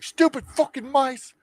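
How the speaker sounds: high put-on voice, irritated; mock annoyed character voice; annoyed grumble. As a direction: grumble it like a fed-up guy. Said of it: "He's like".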